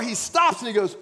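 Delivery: ordinary talk, nothing marked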